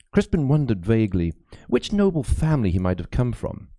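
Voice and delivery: plain reading voice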